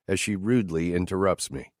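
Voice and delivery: ordinary talk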